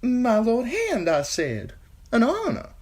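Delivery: high, slow, halting title in fear; scared singer correcting himself; frightened singer. A narrator's reading. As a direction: repeat it nervously. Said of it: "turned to lead".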